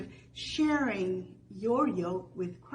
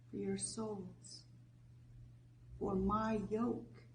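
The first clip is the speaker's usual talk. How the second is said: scripture reading; 4 dB quieter than usual. Acted. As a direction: read it gently, letting each phrase settle. Slow scripture reading retaken.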